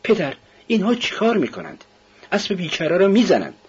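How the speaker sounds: put-on high, distressed child's voice; child crying out. A narrator's reading. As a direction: plead high like an upset child. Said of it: "بچه فریاد کرد". (صدای زیر کودکی مضطرب)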